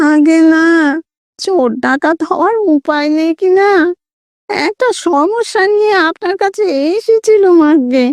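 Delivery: high nasal ghostly voice. A narrator's reading. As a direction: play a timid ghost speaking through the nose. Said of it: "খোনা স্বরে".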